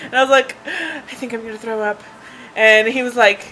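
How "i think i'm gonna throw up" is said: gasp, then a quiet weak voice; mock queasy panic. Patient warning the paramedic, mildly acted queasy panic. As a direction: say it faintly after a gasp, as if nauseous.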